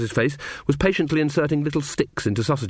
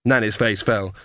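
ordinary delivery, nothing marked

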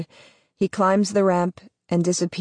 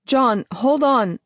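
higher, drawn-out call; calling him back; 7 semitones higher, slower than usual. A narrator's reading. Narrator calls John back from the ship's ramp, higher and slow.

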